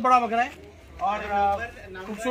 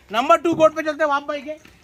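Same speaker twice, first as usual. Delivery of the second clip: ordinary talk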